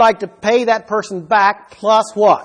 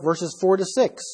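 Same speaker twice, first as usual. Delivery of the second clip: ordinary delivery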